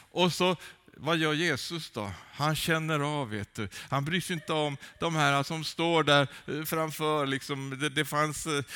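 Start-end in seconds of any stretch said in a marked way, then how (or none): none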